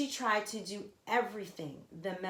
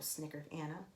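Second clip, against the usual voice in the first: ordinary delivery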